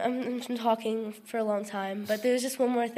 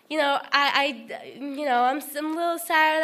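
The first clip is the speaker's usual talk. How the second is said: normal talking voice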